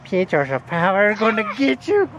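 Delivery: mock-menacing high tease; teasing I'm gonna get you; playful monster voice. Playful threat to kid.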